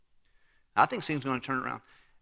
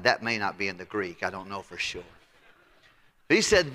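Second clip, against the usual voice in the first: normal talking voice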